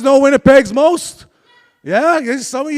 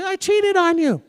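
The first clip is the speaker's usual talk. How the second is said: much higher, lighter imitation; higher take; 7 semitones higher than usual. Correcting the girlfriend's phone confession, pitched up high. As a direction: say it up high, the way she really said it. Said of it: "She didn't say it like that".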